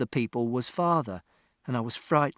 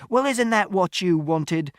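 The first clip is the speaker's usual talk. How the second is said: raised, slow, loud retort; accusing shout; 6 semitones higher, 5 dB louder, slower than usual. A narrator's reading. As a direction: hurl the question back. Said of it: "Father shouted".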